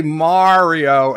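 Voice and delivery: Mario as a long, loud "MAHR-io"; drawn-out first vowel. Shown as a demonstration. Host complains of corrections, Mario with long open "mar". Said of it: "they get upset".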